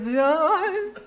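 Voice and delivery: high, drawn-out mock wail, rising; panicked whine. Author representative calling the CEO for help, mock-panicked whine. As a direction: imitate a panicked rep pleading for the boss.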